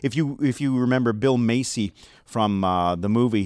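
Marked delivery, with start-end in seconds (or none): none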